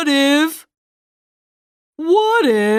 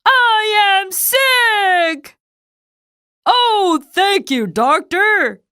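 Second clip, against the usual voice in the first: delighted; 6 semitones higher than usual. Acted. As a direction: savor the good news. Delighted at diagnosis.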